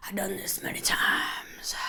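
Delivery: soft whisper in a put-on mystic accent; whispered mock-mystic accent to the viewers; whispered crazy accent. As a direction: confide it like an ancient sage. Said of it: "going to that crazy accent".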